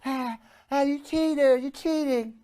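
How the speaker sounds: high, mocking whiny girl voice, breathy and soft; mock girlfriend. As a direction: imitate an accusing girlfriend in a high squeak. Pimp acts out a girl.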